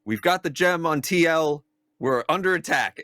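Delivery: high-pitched urgent call; yelled alert. Acted. Player calls into the sending stone, yelled. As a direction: yell it into a small stone. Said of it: "yell into the little sending stone".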